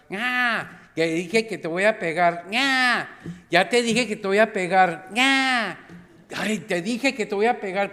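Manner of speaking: from firm to a high, loud yell; hammed-up mother shouting the same threat again and again; escalating mock threat.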